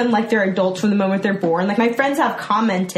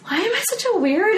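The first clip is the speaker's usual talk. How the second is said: high-pitched mock dismay at oneself; self-mocking squeal; 10 semitones higher, faster than usual. Fan recalling an awkward first meeting, high self-mocking wail. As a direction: squeal it in embarrassed self-reproach. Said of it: "So I'm just like".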